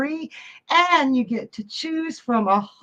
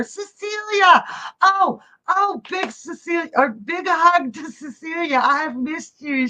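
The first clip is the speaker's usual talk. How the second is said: excited greeting; 6 semitones higher than usual. Real, not acted. Greeting a missed viewer.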